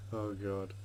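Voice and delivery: ordinary delivery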